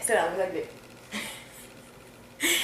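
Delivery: breathy, slow, hushed come-on; seductive whisper. Retold or imitated.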